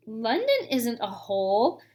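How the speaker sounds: plain reading voice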